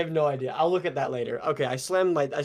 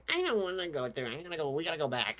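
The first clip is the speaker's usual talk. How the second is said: whiny friend; 3 semitones higher, 5 dB quieter than usual. Retold or imitated. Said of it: "this motherfucker was like".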